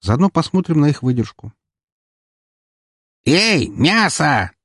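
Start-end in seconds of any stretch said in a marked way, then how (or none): 3.2-4.5 high, slow grating screech; screeching pirate. Acted. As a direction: screech like a saw on metal. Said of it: "раздался визжащий голос".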